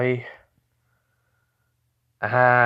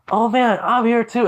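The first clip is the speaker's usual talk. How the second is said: high, loud character outburst; excited character voice; 12 semitones higher, 5 dB louder than usual. Acted. Walking in on the others, high excited cry. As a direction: burst out much higher and louder. Story character cries out on entering.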